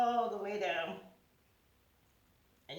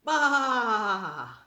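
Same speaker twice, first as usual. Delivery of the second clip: loud playful bleat; mock sheep baa, louder; 7 dB louder than usual. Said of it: "Does a sheep say".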